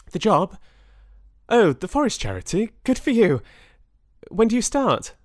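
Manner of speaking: high, loud, absent-minded chatter; high distracted voice. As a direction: answer brightly but distracted.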